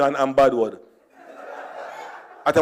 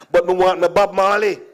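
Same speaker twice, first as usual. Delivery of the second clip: normal talking voice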